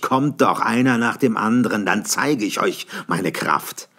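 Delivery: loud dare, slowing at the end; loud slowing taunt; challenging shout. A narrator's reading. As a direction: taunt the enemy to come one by one. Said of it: "rief ich".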